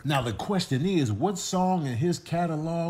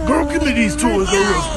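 shouted playful threat; loud mock demand; 7 semitones higher, 11 dB louder than usual. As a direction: bark it like a bossy kid. Voiced demand for toys.

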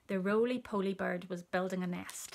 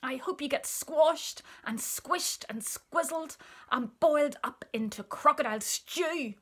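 higher, swooping curse; outraged cry; 5 semitones higher, 5 dB louder than usual. A narrator's reading. Elephant curses the child-eating crocodile, high outrage. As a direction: pile up the insults, building louder. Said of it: "cried Chunky".

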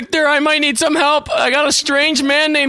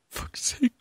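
unvoiced whisper; 12 dB quieter than usual. Real, not acted.

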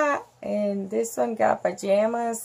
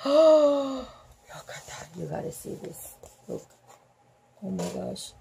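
awed whisper; 6 dB quieter than usual. Real, not acted. Reader awed by the ghost, gasping then whispering. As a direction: whisper in awe.

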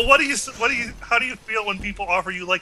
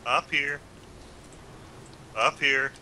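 sexy voice; 4 semitones lower, 10 dB quieter, slower than usual. Acted. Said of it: "trying to say in his sexy voice".

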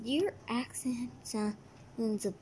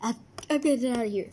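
hurried; 2 semitones lower, 4 dB louder, much faster than usual. Acted. Fleeing the cat, fast.

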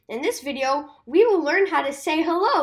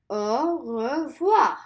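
"Au revoir" said very slowly; slow repeat-after-me "Au revoir"; slow model; much slower than usual. Shown as a demonstration. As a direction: stretch "Au revoir" for kids to copy. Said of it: "let's say goodbye one more time together".

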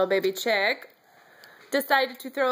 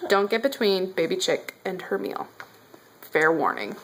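normal talking voice

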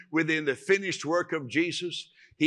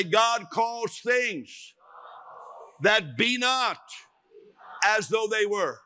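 higher, very slow, ringing declaration; slow proclamation; 6 semitones higher, slower than usual. Shown as a demonstration. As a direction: call it out word by word.

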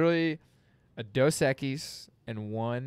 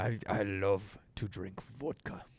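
slow put-on Russian accent; deep Russian accent; 3 semitones lower, 4 dB quieter, slower than usual. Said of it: "very deep Moscovian tone".

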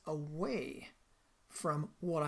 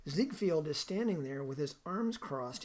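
usual speaking voice

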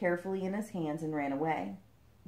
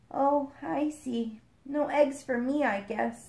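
sad; 7 semitones higher, slower than usual. A narrator's reading. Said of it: "shaking her head sadly".

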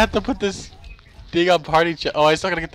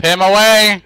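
louder, slow, drawn-out cry; urgent yell; 2 semitones higher, 7 dB louder, slower than usual. Real, not acted. Player fending off an enemy, urgent drawn-out yell. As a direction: yell it out, stretching each word.

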